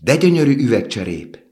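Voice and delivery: put-on little girl's high, loud cry of wonder; delighted exclamation. A narrator's reading. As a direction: exclaim with a big swoop up in pitch. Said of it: "kiáltott fel a kislány".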